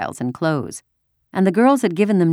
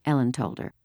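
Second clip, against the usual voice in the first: ordinary delivery, nothing marked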